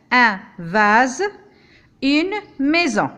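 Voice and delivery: vase and maison, each S buzzed as Z; repeated Z examples. Shown as a demonstration. Teacher repeating the examples, S as Z. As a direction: repeat the words, buzz each S. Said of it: "Je répète".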